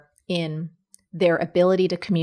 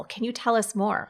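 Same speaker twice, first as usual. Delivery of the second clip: normal talking voice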